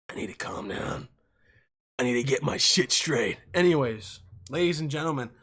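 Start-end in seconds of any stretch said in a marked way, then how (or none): 0.1-1.1 whispered self-soothing. Real, not acted. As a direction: lower whispered calm-down. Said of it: "I need to relax".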